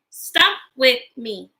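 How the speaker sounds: ordinary delivery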